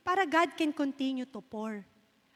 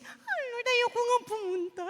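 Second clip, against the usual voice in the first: high, mock-reluctant plea to God; reluctant pleading; 9 semitones higher than usual. Praying woman balks at the Lord's order, reluctant pleading. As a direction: act out not wanting to go. Acts out reluctance at God's instruction.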